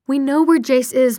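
eager, forceful reply; urgent explanation. A narrator's reading. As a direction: burst out with the news. Daughter urgently explains to mother.

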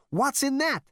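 loud, very high demand; alarmed, distrustful question; suspicious. A narrator's reading. Zaphod asks what the towel holds, suspicious. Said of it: "asked Zaphod suspiciously".

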